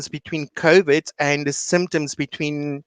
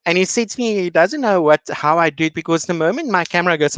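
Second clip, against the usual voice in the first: normal talking voice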